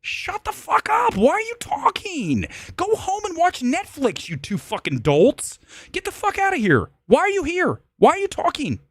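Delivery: very high-pitched exasperated yelling; acted angry rant. Fed-up moviegoer to the whispering couple, acted angry rant.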